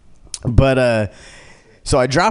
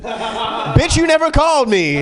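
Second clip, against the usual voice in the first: mock outraged; 11 semitones higher, slower than usual. Third rider confronts Allison.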